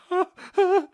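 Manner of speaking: high, loud hiccuping cries; fear-choked gasps. A narrator's reading. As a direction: gasp in terror, like hiccups. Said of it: "Fear choked her like horrid hiccups".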